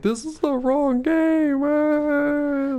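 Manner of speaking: imitating his son. Father imitating his laughing son in a high, slow voice. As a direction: pitch way up to mimic the boy. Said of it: "he'd be like".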